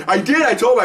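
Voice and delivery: excited. Retold or imitated. Retelling friend's proud claim.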